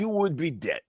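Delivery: put-on movie-style Russian accent. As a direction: finish the threat in a Russian accent. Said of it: "that's a good Russian accent".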